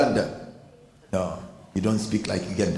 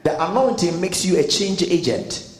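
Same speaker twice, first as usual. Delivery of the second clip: full-force preaching; 5 semitones higher than usual. Real, not acted. Pastor urging the congregation, preaching hard. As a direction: preach it out to the church.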